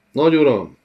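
"Nagy uram!" loud and high; sudden outburst. Acted. Envoy blurts out a plea to the lord. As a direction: snap it out before you can stop. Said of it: "csattant fel egy hang hirtelen".

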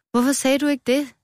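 high-pitched astonished question; surprised. A narrator's reading. Old strawberry hears the confession, surprised. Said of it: "gispede det gamle jordbær overrasket".

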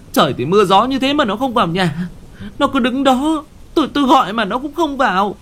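high, fast, frightened rush of words; terrified wife. Acted. Wife tells husband she saw their daughter's ghost, high and panicked.

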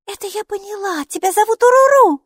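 very high-pitched little character voice; Майки. A narrator's reading. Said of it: "согласилась Майки".

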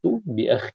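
بأخ said bi-akh-khin; doubled kha. Shown as a demonstration. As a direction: geminate the kh in بأخ, ending -in. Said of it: "بتشديد".

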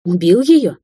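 loud, alarmed question; frightened. A narrator's reading. Girl asking whether the old woman was killed, frightened. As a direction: blurt it out in shock. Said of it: "Дина испуганно вцепилась в подоконник".

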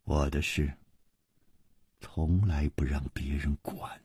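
very low, near-whispered warning; glaring rebuff. A narrator's reading. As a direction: shut them out in a hushed, cold voice. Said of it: "瞪着一点红说".

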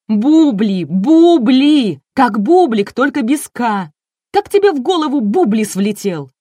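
громко и высоко вопить в сердцах; орать на ведущего, теряя терпение; яростный крик. A narrator's reading. Энн кричит и бьёт ведущего листом. (гневный крик на высоких нотах)

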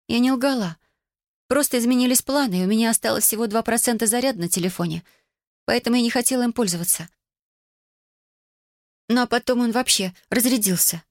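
put-on high-pitched girl making excuses; defensive teenage daughter. A narrator's reading. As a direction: lift the voice high and justify yourself. (подросток оправдывается высоким голосом)

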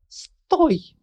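high, loud commanding shout. Acted. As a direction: yell it to stop her. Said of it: "прокричала".

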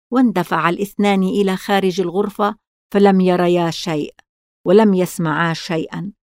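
usual speaking voice

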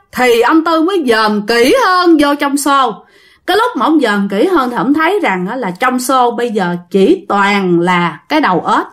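plain reading voice

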